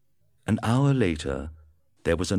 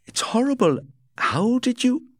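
much higher, louder, aghast; horrified Kemp; 6 dB louder than usual. A narrator's reading. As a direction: blurt it out in shock. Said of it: "he said".

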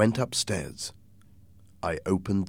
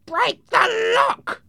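harsh, slow, rasping shout; shouted order; 5 dB louder, much slower than usual. Acted. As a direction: bellow the command, drawing out each word. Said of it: "a third voice shouted".

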